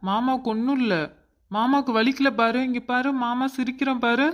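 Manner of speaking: put-on light sing-song comforting of a child; cheering up a child. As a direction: show the little one you are fine. Uncle cheers up crying niece.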